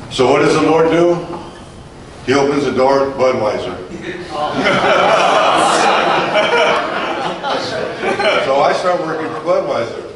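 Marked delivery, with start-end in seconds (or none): 3.8-8.4 a long real laugh, high; laughing hard at himself. Real, not acted. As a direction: crack up at the irony. Laughs at Budweiser job irony.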